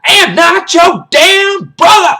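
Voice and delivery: high, screamed drill-sergeant rage; furious outburst. Acted. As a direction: explode into slow full-volume shouting. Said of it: "He's like".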